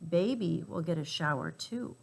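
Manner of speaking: usual speaking voice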